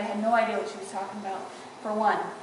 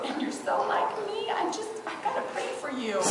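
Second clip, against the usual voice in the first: grandma; 7 semitones higher, 4 dB quieter than usual. Retold or imitated. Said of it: "she'd go".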